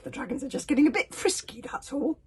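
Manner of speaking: loud high dismissive bluster. A narrator's reading. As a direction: wave it away loudly. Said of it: "blustered Stoic".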